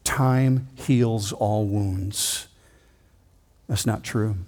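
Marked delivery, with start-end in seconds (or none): 0.0-2.5 very slow, soft quoting of the saying; drawn-out, quiet old saying; quoted adage. Retold or imitated. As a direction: lay out the proverb slowly. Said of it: "in which we say".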